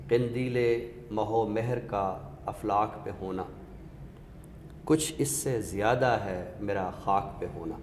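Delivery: measured ghazal recital, a touch of weight. Acted. Recites Sarwat Hussain couplet.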